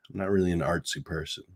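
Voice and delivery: rushed, dropped-down repeat; quick quiet aside. Real, not acted. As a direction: mutter it again quickly to yourself.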